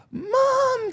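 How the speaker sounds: put-on high-pitched little Australian kid; Australian accent. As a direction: imitate a small child's Australian accent, very high. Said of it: "in his little, uh, Australian accent".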